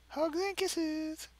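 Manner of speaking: high, very slow cutesy lilt; mock sweet sign-off. Reader coos Kyle's mock-sweet sign-off. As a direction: coo it sickly sweet.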